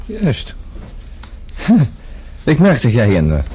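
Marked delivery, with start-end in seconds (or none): 0.6-2.4 breathy amused laugh; soft knowing chuckle. Acted. Guest amused by the maid's business sense, soft chuckle. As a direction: chuckle at her frankness.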